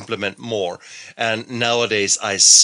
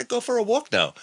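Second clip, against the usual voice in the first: light inner voice. Retold or imitated. Voicing inner thought.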